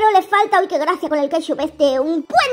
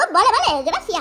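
ordinary talk, nothing marked

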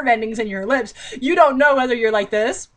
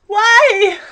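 higher, louder pleading why; exasperated; 10 semitones higher, 7 dB louder than usual. Real, not acted. Reader to viewers, exasperated at the book. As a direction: plead to the ceiling in disbelief.

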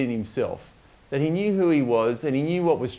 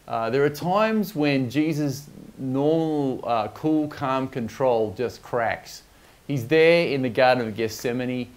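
ordinary talk, nothing marked

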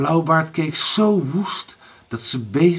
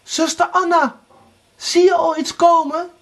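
high, raised call; 5 dB louder than usual. A narrator's reading. Erika calls to Anna in the tower, high and loud. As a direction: call out to someone far above. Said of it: "riep Erika door het venster naar boven".

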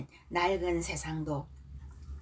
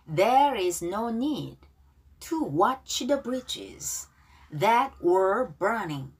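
slow model reading; much slower than usual. Shown as a demonstration. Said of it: "자, 발음 따라하기".

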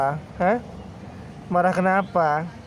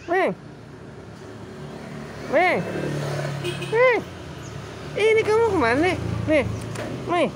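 much higher pet-talk question; high coaxing voice; 11 semitones higher than usual. Real, not acted. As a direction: sing it up high to coax a cat. Coaxing the cat.

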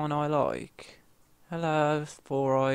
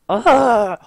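much higher, louder angry ugh; loud groan of frustration; 9 semitones higher, 11 dB louder than usual. Real, not acted. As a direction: groan as it goes wrong again. Frustrated again in game.

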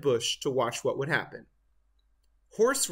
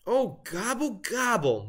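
moaning; 7 semitones higher than usual. A narrator's reading. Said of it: "moaned Turkey".

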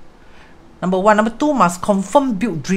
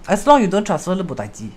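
ordinary delivery

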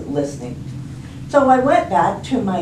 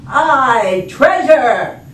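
loud, higher crew cheer; shouting pirates; 6 semitones higher, 6 dB louder, much slower than usual. Acted. Crew answers captain about treasure, shouting. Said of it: "they all shouted".

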